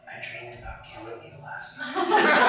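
soft whisper into someone's ear; hushed, intimate acted whisper. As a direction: whisper it close, like a secret. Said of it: "she whispers in my ear".

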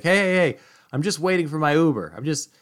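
loud, high call across a parking lot. Retold or imitated. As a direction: shout over to the departing car, eager. Timothy calls out across parking lot.

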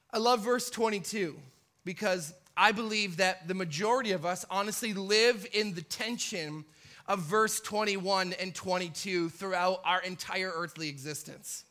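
ordinary talk

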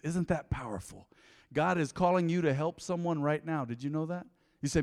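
usual speaking voice

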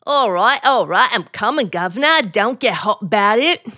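brisk, cheeky Cockney; Cockney maid. Pretend. Wife posing as maid answering the bell, in Cockney. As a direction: rattle it off in broad Cockney. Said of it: "you don't like me as a Cockney".

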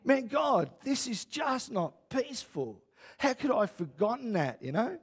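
high-pitched mild mock anguish; retold tearful despair. Preacher relives his prayer to God, mildly anguished. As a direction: plead upward in lightly acted despair, easing off. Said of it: "tears bawling my eyes out".

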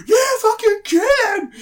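very high, strained scream; hammed-up screaming wrestling fan; mock fan shout. Imitating a bloodthirsty fan at a wrestling show, high strained shout. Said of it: "people weren't shouting, like".

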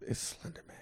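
whispered, hushed spooky reveal. Pretend. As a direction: breathe it like a ghost story. Spooky telling of trees whistling.

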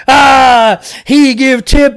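mock tip cheer. Imitating the lady cheering a tip, loud mock wench cheer. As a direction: bellow it like a fair barmaid. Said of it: "hitting this axe on this tip thing".

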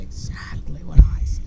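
whispery fading repeat; imitated echo. Fan plays the echo copying him, whispered.

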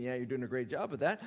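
quick, low, dry aside; sarcastic inner thought. Retold or imitated. Jesus thinking back at Pilate, dry sarcastic aside. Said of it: "Jesus is probably thinking".

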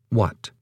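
What? set apart and stressed, falling; emphasized what. Shown as a demonstration. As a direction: lean hard on What, alone. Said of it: "The first word to emphasize would be what".

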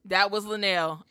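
normal talking voice

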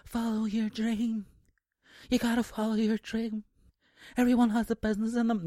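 high, put-on dreamy motivational voice; mocking motivators. Mocking people who say follow your dream, dreamy. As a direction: parody a cheesy life coach. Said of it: "some people like going".

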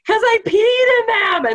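high, loud re-enacted yell; yelled over the shoulder. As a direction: call it back while running away. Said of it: "I just yelled over my shoulder".

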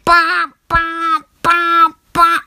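insistent high alarm honk; better iPhone alarm. Retold or imitated. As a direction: blare it like a phone alarm, evenly repeated. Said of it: "That's better".

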